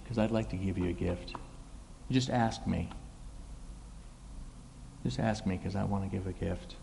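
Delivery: softly, invitingly offering a gift; soft gentle offer. Retold or imitated. Preacher voicing Jesus inviting her, soft and gentle.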